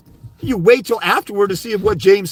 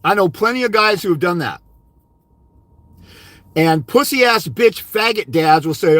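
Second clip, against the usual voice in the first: ordinary talk, nothing marked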